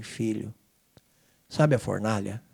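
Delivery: Senhor falando baixo e mais grave, com ternura; voz divina baixa e serena. Retold or imitated. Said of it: "o senhor fala pra mim assim". (o Senhor consola o filho no aeroporto, em voz baixa)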